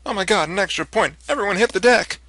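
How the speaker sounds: high, loud sarcastic panic; mock alarm. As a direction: fake a panicked warning. Mock alarm at extra point.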